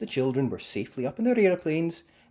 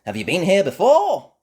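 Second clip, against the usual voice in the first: high, loud friendly question; cheerful Miss Rabbit; 9 semitones higher, 12 dB louder than usual. Acted. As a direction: greet the kids brightly. Miss Rabbit greets the children.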